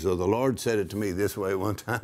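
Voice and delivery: ordinary talk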